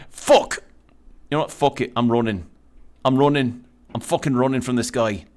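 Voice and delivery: put-on urgent, amateur Irish accent on the run; unpractised Irish, bursting out in panic; attempted Irish accent. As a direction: flee in a rough Irish accent. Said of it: "for Irish accents, I don't really have".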